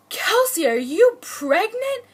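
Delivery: high, loud gushing imitation of a thrilled grandmother; hammed-up delighted squeal of words; excited grandma. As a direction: act out a grandma bursting with joy. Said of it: "she was so excited".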